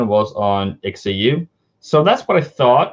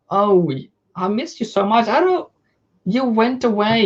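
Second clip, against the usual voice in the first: much higher, gushing welcome; delighted greeting; 8 semitones higher, slower than usual. Real, not acted. Greeting returning viewer Chip Chang in chat, delighted and much higher.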